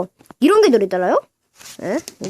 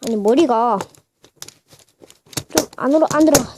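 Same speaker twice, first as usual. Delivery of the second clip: ordinary delivery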